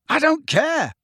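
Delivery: very high, furious cry; yelling. A narrator's reading. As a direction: yell it at all of them. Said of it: "Harry yelled at them".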